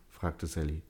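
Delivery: ordinary delivery, nothing marked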